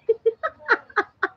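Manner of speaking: high-pitched burst of laughter; laughing. Real, not acted. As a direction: crack up at your own accent. Amused by her own accent. (matinis na halakhak)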